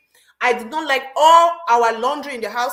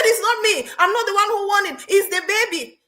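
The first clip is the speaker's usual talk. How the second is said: protesting; 8 semitones higher than usual. Retold or imitated. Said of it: "I said".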